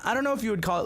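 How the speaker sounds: usual speaking voice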